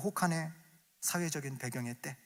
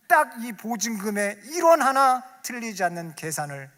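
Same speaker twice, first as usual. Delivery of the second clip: emphatic preaching; 7 semitones higher, 10 dB louder than usual. Acted.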